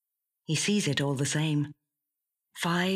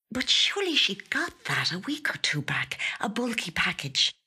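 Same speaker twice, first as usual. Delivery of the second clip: whispered thoughts; 4 semitones higher than usual. A narrator's reading. Louise's inner muddled thoughts.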